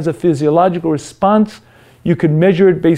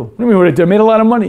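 defensive retort; 4 semitones higher, much faster than usual. Acted. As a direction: protest like he's done nothing wrong. Self defending.

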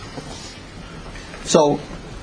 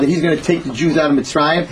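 normal talking voice

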